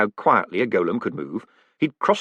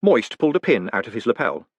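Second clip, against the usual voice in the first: usual speaking voice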